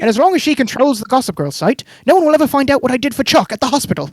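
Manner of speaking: put-on a British accent read as a smug villain's phone call. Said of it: "you like my British accent?".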